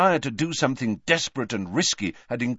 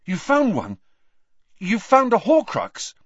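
high, rising disbelief, louder on the second question; astonished excitement; 12 semitones higher, 5 dB louder than usual. Acted. Harry to Dumbledore on the Horcrux news, astonished and high. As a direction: blurt it out in amazement.

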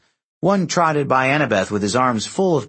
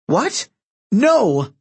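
high startled cry, louder on the second word; shocked denial; 10 semitones higher, 4 dB louder than usual. A narrator's reading. Demigod accused of betrayal, high shocked denial. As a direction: protest in sudden disbelief. Annabeth denies betraying the Romans.

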